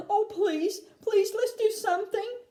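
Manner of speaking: high-pitched anxious begging; desperate plea. A narrator's reading. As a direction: beg urgently, high in the voice. Desperate mother begging for help.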